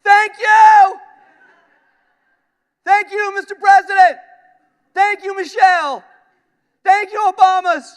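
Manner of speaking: very high, loud shouts of thanks; drunken yelling. Retold or imitated. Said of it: "I just start yelling".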